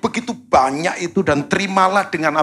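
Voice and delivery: high, loud, lifted reading of the verse; solemn preached Bible reading; scripture reading. Acted. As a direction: proclaim the verse to the congregation. Reads Bible verse aloud.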